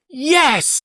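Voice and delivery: put-on high, loud childish yes; child agreeing. A child agreeing daddy is mean, high and loud. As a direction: blurt it like an indignant kid. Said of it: "They're like".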